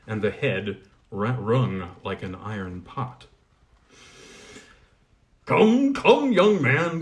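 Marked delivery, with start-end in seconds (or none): 5.4-7.0 higher, louder, slow cry; the smith calling out to stop him. Acted. Goblin smith crying out to Colin after the blow. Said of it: "cried the smith".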